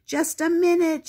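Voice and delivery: high, loud shout from inside; startled shout. A narrator's reading. Steve shouting to Spot at the door, high and loud. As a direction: yell it while chasing the ball. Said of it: "shouted Steve".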